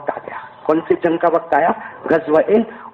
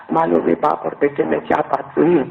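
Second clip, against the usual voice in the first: usual speaking voice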